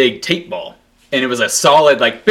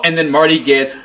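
usual speaking voice

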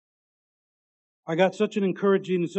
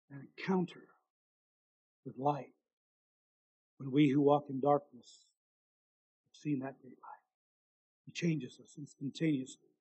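low, much softer, intimate; soft preaching; 4 semitones lower, 6 dB quieter than usual. Acted. Preacher speaks softly to the listeners about God's light. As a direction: draw them in quietly.

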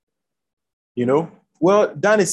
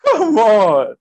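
exclaiming; 4 semitones higher, 6 dB louder than usual. Real, not acted. Preacher exclaims.

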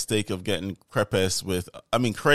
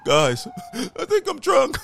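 high, slow drunk friend impression; mock drunk confession, much higher; tipsy Whitney; 4 dB louder, slower than usual. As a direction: slur it slowly in a squeaky tipsy voice. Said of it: "looking at Whitney".

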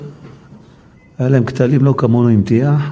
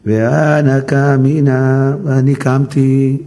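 slow quote; 3 semitones higher, much slower than usual. Acted. Slow Aramaic quote and translation.

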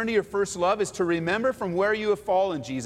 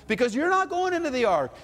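lightly raised, hammed-up divine instructions; mock voice of God; 7 semitones higher than usual. Preacher mocking God's instructions to Noah, lightly raised voice. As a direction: play God giving silly orders, a bit higher. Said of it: "God doesn't come to Noah and say".

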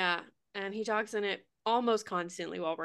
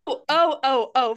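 excited; 3 semitones higher, 7 dB louder than usual. Real, not acted. Excited announcement to listeners.

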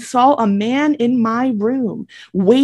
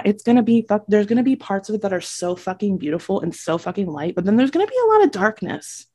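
normal talking voice

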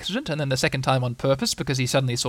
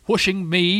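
higher-pitched, affronted astonishment; surprised, pitch lifted; 6 semitones higher, 4 dB louder, slower than usual. A narrator's reading. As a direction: repeat it in disbelief. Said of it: "said Eeyore in surprise".

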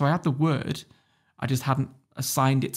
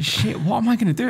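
mock panic; 8 semitones higher, 4 dB louder than usual. Panicking in the queue.

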